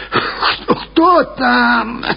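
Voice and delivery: put-on high, husky old crone calling out; coughing old woman's cry. A narrator's reading. Old woman to students knocking at her gate, coughing cry. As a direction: call out through a muffled cough. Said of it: "закричала она, глухо кашляя".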